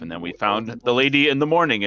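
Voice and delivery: ordinary talk, nothing marked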